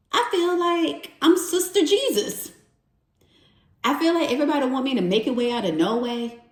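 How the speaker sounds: high-pitched retold complaint, speeding up; exasperated recap of an upset moment. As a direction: replay being fed up, pitched high. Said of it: "I was so upset".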